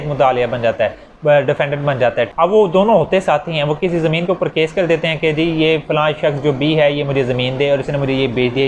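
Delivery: ordinary talk, nothing marked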